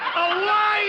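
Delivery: triumphant high shout; 1 exultant shout. Acted. Quiz host shouting the winning answer excitedly. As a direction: crow the answer like a winner.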